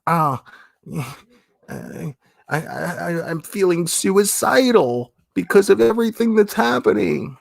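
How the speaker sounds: high-pitched, stammering mock complainer; mock whining. Streamer mimicking people who complain about online bullying, whiny mockery. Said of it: "Sitting here talking about".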